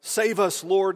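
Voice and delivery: loud, high panicked plea; frantic cry for help. Acted. Disciples in the sinking boat, crying loudly to Jesus. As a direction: shout it like men about to drown.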